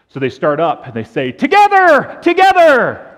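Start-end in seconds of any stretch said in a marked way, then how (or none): 1.3-3.1 very high, loud bleated shout; sheep crying out. Acted. Two dumb sheep calling for their shepherd, crying out. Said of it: "if we cried out together".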